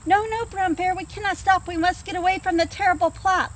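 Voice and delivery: high, anxious voices; scared animals. Acted. Animals answer bear.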